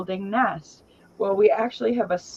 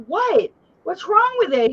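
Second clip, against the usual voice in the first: mock alarm; 13 semitones higher, 5 dB louder than usual. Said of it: "I'm like".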